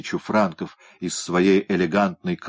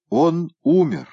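выше и громче, объявляет смерть; торжественно объявить всем; громкое объявление; 10 semitones higher, 5 dB louder, slower than usual. A narrator's reading. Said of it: "объявил Петернья". (Петернья громко объявляет всем о смерти кота)

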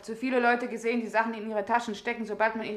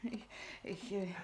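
frightened stammer; 3 semitones lower, 15 dB quieter than usual. Acted.

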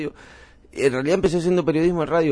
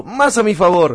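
retold triumphant retort; 5 semitones higher, 6 dB louder, slower than usual.

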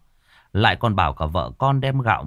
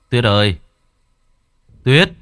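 louder shout for his wife; calling louder; 8 dB louder than usual. A narrator's reading. Husband calls his missing wife, shouting louder. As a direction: call her name out loud across the silent house. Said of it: "Gã lại gọi".

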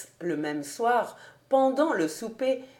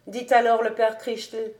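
plain reading voice

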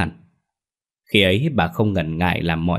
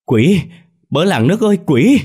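shrieking for help, much higher and louder; terrified scream; 11 semitones higher, 8 dB louder than usual. Acted. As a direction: scream for the village in terror. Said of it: "Tiếng hét thất thanh". (hét thất thanh, kinh hãi)

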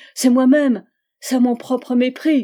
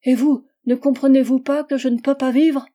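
réplique désespérée; 4 semitones higher, 7 dB louder than usual. Acted. Charlotte réplique avec désespoir.

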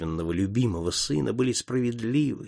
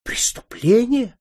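much higher, louder, drawn-out shriek; shocked cry; 13 semitones higher, 5 dB louder, slower than usual. A narrator's reading. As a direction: countess shrieking the word back at her son in disbelief. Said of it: "крикнула графиня". (потрясённый протяжный крик гораздо выше и громче)

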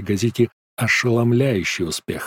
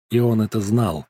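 ordinary talk

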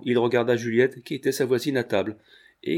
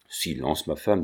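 menacing; 7 semitones lower than usual. A narrator's reading. Husband silencing his wife at table, low and menacing. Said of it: "dit Patureau d'une voix menaçante".

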